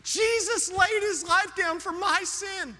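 ramp up into full preaching fire; preaching climax. Real, not acted. Sermon climax building.

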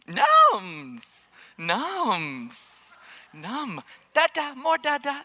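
put-on high babyish happy babble; mock toddler munching noises; imitating toddler. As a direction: do the kid suddenly loving it. Toddler voice after tasting.

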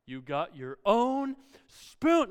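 slow, drawn-out mock amazement; sarcastic echo. Dad echoing child's boast about the spoon, slow sarcasm. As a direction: stretch OWN and SPOON, loud and sarcastic.

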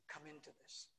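ordinary delivery

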